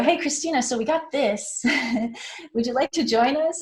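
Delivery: high, chipper voice of the organizer coming back; a lightly voiced eager organizer pitching again; upbeat organizer. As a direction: sell it brightly to Christina. Organizer returning with an offer.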